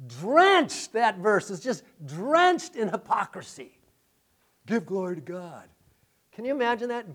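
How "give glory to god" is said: quiet mock pious voice. Pastor mocking the Pharisees' piety, softer. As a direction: sneer the pious line softly. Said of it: "in one voice".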